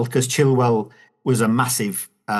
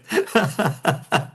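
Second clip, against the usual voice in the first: laughing; 7 semitones higher than usual. Real, not acted. Joking about flattering the host.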